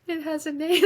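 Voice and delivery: put-on high, small child's voice, amazed; little girl. Five-year-old self realizing her feeling has a name, high childlike voice.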